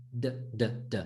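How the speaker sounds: "D" as a short, heavy Arabic Dad; low, soft, heavy "d"; heavy dal. Shown as a demonstration. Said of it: "like a heavy dal".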